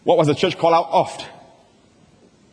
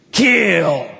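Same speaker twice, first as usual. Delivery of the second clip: emphatic shout. Real, not acted. Preacher stressing the threat.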